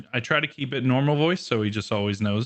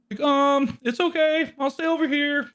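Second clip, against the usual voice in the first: much higher, timid and nervous; timid cat voice, like Morty. Retold or imitated. Voicing the scared cat, a high timid Morty squeak. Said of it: "kinda like Morty".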